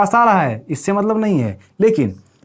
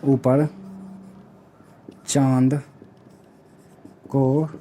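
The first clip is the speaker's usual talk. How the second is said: slow dictation; 3 semitones lower, slower than usual. Shown as a demonstration. Teacher slowly dictating the memory trick for notes. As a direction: dictate each word of the trick slowly, with long pauses. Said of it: "इसका हमने एक trick बनाया है".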